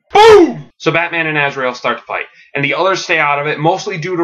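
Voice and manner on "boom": loud, high explosive boom; shouted boom. Acted. Narrator punctuating Batman and Azrael's fight, shouted boom. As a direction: shout it like a sudden blast.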